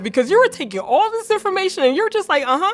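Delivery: high, fast, animated retold voice; imitating the surgeon. Surgeon to patient who finally cries, imitated high and rapid. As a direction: rattle it off high and loud, mimicking the surgeon.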